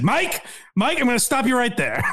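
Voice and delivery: high, laughing mock interruption; mock Pat interrupting. Host mimics Pat stopping his son mid-sentence. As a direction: cut him off in a lightly hammed Pat voice. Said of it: "Now he's like".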